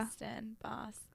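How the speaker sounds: very soft, trailing into whisper; trying the word under one's breath; hushed practice. Shown as a demonstration. Practicing the accent to herself.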